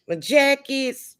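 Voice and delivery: high, slow and drawn out with delight; excited showing off. Real, not acted. Presenting her jackets to viewers, high and delighted. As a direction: savour the words like unveiling a treasure.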